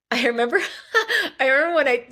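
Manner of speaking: high laugh breaking into the words; giggling through the memory. Real, not acted. Recalling her parents' reaction, laughing.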